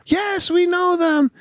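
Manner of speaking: high, drawn-out kiddie answer; imitated little girls. Little girls answering the wife, high imitated child voices.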